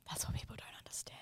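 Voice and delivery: breathy whispered aside; whispering. Real, not acted. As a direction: drop to a whisper. Aside to co-host.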